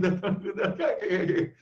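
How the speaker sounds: high, light mock cackle; put-on giggling run, high and rattling; imitated laughter. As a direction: imitate laughter catching from person to person. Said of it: "o outro também começa a rir".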